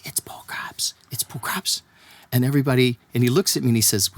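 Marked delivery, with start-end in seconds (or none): 0.0-1.8 hushed, whispered crowd recognition; imitated awed gasp. Ferry crowd spotting the star, gasping in whispers. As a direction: whisper it like stunned onlookers. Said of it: "and they gasp, and they say".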